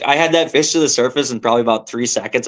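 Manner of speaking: ordinary talk, nothing marked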